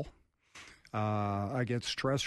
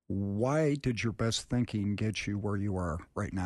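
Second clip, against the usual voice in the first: normal talking voice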